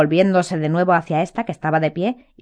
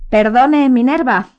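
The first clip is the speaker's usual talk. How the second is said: louder, simpering sweetness; silly smile; 5 semitones higher, 7 dB louder, slower than usual. A narrator's reading. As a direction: smirk through it. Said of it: "con una sonrisa tonta".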